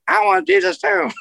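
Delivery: very high squeaky puppet voice. Retold or imitated. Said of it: "he did it, like".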